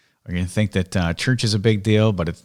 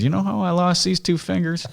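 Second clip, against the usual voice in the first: teasing grandpa; 10 semitones higher than usual. Retold or imitated. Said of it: "and he says".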